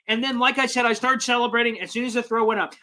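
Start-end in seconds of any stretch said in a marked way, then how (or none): none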